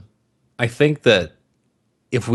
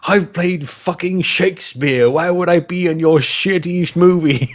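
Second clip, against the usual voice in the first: mock haughty Kingsley; 7 semitones higher, slower than usual. Imagined Kingsley's haughty refusal.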